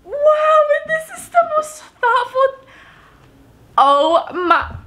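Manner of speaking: very high, tearful amazement; overcome. Real, not acted. Vlogger moved by a thoughtful gift, overcome. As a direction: squeak it out, moved nearly to tears. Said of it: "I'm going to cry".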